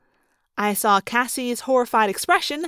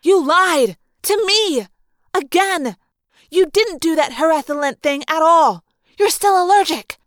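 high, loud, indignant outburst; furious, punched-out phrases; angry accusation; 5 semitones higher, 7 dB louder than usual. Acted. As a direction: yell it as an outraged accusation. Cassie angrily accuses the narrator.

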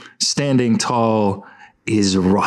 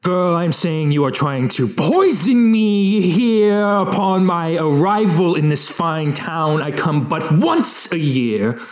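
pompous outraged critic; 6 semitones higher than usual. Acted.

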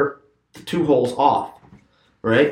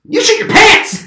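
much higher, louder, cackling the accusation; laughing taunt; 6 dB louder than usual. Retold or imitated. Keith teasing his fishing buddy, laughing taunt. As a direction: crow it out through a laugh.

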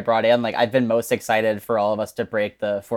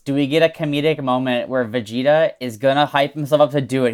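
ordinary delivery

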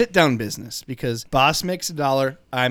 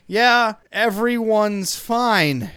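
strained reassurance; 6 semitones higher, 4 dB louder, much slower than usual. Retold or imitated.

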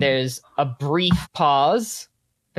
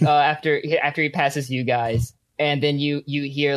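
ordinary delivery

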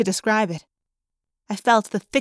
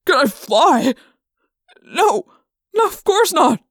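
high, loud, nervous no; panicky denial; 6 semitones higher, 5 dB louder, slower than usual. Acted. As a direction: refuse in a panic. Trainer asked if he can fly.

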